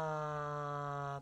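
long held AH on a single level pitch; sustained, unchanging drone; one-note monotone. Shown as a demonstration. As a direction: hold AH dead level. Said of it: "speaking on the one note".

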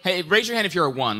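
ordinary delivery

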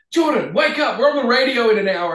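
high, loud urgent calling; panicked wake-up shout. Retold or imitated. She wakes Jordan before the radio show, alarmed shout. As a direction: shout him awake in alarm. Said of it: "And she went".

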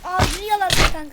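shouted at his friend, loud; loud outburst during play; loud yell. Real, not acted. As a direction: yell it out in frustration. Yelling at the game.

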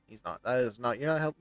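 ordinary talk

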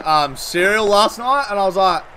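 ordinary talk

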